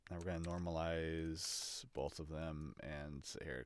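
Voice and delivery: normal talking voice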